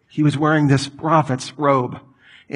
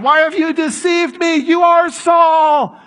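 very high, slow shriek, louder at the end; frightened shriek; 13 semitones higher, 4 dB louder, slower than usual. Retold or imitated. Medium realizes the king deceived her, terrified shriek. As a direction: shriek in terror at being tricked. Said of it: "She said".

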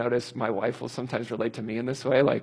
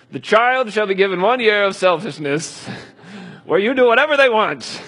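higher, louder mock-solemn reading; mock scripture; 7 semitones higher, 6 dB louder than usual. Preacher mocking the idea of a verse, hammed-up solemn tone. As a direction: ham up a fake Bible verse.

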